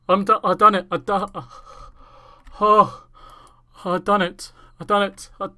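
can't get the words out, still in shock; breathless joyful disbelief; real excited shouting. Real, not acted. Still overjoyed after the win.

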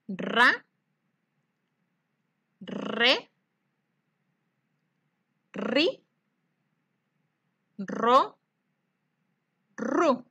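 each syllable said clearly with long pauses for the echo; syllable drill. Shown as a demonstration. Teacher leading children to repeat syllables. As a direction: leave room after each syllable for the class to repeat. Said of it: "Repitan conmigo".

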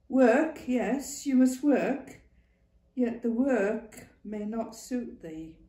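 lightly voiced old Northern woman; brisk, knowing old woman. A narrator's reading. Ma Duckworth voice.

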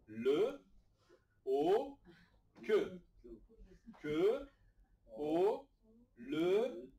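"Le, o, que" each sound said loud and apart; sounds one by one. Shown as a demonstration. Teacher breaks the word into sounds for the class, loud and spaced. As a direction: call out each sound separately, firmly. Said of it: "spelling sounds one at a time".

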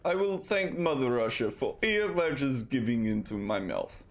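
hammed-up Russian accent thanking Mother Russia; light Russian accent, mock solemn. Gamer giving thanks to Mother Russia in a mock Russian accent.

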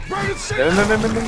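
high, pressing call to come over; urgent calling. Real, not acted. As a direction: hurry them over to you. Calling teammate over in game.

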